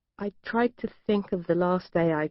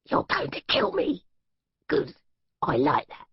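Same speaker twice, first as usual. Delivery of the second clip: menacing captor; 3 semitones lower than usual. Acted. Captor mocking threat.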